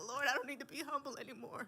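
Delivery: high, loud pleading to the Lord; mock prayer. Guest acting out her prayer to God, high and loud. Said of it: "I was like".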